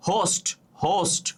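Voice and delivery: "হোস্ট" said on its own with a clear h; teacher models "হোস্ট", h pronounced; sounded H. Shown as a demonstration. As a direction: begin "হোস্ট" on an audible h. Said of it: "হ উচ্চারিত হয়েছে".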